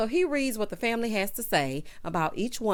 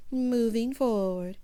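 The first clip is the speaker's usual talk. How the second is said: normal talking voice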